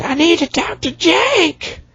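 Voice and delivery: very high, slow, put-on girl voice; high girly mock voice. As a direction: squeak it out slowly like a whiny teen. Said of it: "She's like".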